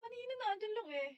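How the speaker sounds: lilting Bulacan accent, pitched high. Shown as a demonstration. Showing viewers how Bulakenyos talk, high Bulacan accent. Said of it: "sa punto".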